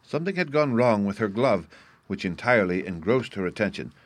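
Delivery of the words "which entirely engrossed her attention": usual speaking voice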